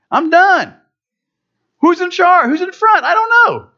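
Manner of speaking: very high-pitched, squeaky bewilderment; mock exasperation. Preacher losing track of a car race, mock exasperation. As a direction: throw it up high, comically fed up.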